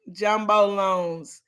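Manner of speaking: ordinary delivery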